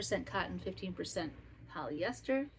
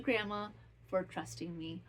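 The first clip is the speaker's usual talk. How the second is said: ordinary talk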